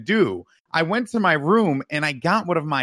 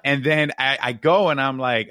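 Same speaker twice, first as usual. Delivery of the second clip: normal talking voice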